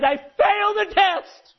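high, slow, drawn-out shout; anguished shout. Acted. Peter realizing he denied Jesus, anguished shout. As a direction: cry out the realization at full voice.

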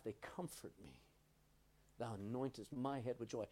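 soft, broken, tearful reading; grieving friend reading the psalm, soft and tearful; tearful recital. Retold or imitated. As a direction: recite it softly through tears. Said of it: "with tears in his eyes".

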